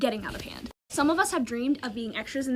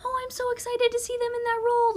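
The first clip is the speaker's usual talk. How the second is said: much higher, gushing fake-excited fan voice; mock the fake online fans with a sugary high gush; 12 semitones higher than usual. Mocking fake social media fans over the casting, sugary high-pitched gush. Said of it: "be so freaking fake".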